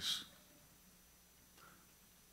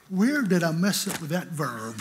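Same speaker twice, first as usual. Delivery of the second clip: louder, laughing through the line; laughing retelling; 2 semitones higher, 5 dB louder than usual. Retold or imitated. Preacher laughing as he quotes himself back at his books. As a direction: chuckle at your own nerve.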